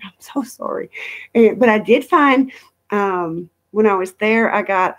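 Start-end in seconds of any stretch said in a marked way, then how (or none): 0.0-0.9 softer, breathy apology whispered to an angry husband. Retold or imitated. Apologizing to her mad husband, whispered and sheepish. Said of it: "I was like".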